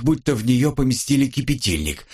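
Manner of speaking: ordinary delivery, nothing marked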